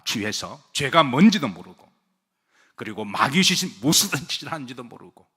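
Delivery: normal talking voice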